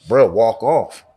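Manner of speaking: ordinary delivery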